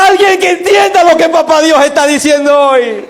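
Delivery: loud, high shouted appeal; fervent shouted call; preaching shout. Real, not acted. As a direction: shout it to the whole church.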